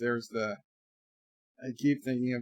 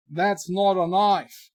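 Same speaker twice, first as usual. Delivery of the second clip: slow, loud Aussie catchphrase, higher than usual; mock Australian accent; 5 semitones higher, 6 dB louder, slower than usual. Partygoer topping the next biggest knife, mock Australian accent. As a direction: draw it out like an Aussie film hero.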